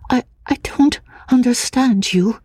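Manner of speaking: put-on high, hesitant, frightened girl's voice; a nervous fragile woman, stammering; lightly breathy, fearful young woman; timid young woman. A narrator's reading. Anne's bewildered voice.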